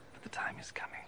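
soft secret whisper. Acted. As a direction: lean in and whisper it. Jesus revealing a solemn truth.

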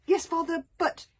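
put-on a young son cutting in; boy's voice. Hiccup answers his father.

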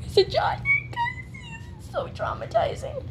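high strained whimper of pain; pained cry. Real, not acted. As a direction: wail through the hurt. Stepping on Legos, crying out.

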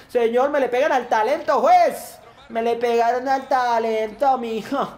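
high, hammed-up wail at the referee; indignant football-fan outcry; outraged protest. Real, not acted.

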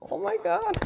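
very high squeal breaking into a laugh; delighted squeal. Real, not acted. Friend amazed by her gift box, high delighted squeal. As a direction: squeal it in happy disbelief.

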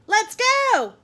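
loud, high, urging shout; calling out. A narrator's reading. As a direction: holler to hurry him along. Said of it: "called his father".

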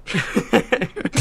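short high-pitched laugh. Real, not acted. Amused after joking about working as a courier, laughing. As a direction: laugh briefly, pitched well up.

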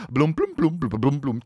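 high plunking "bloom" notes, falling away; vocal imitation of quirky scene music; mock TV music cue. Mocking Grey's Anatomy's music, plinky imitated cue.

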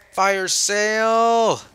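slow, loud drawn-out announcer; loud drawled game announcer; announcer call. Retold or imitated.

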